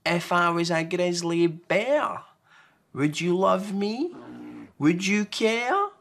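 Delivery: put-on high, slow pleading child voice; Small, anxious little one. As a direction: ask it hoping for a yes. Small asks Large.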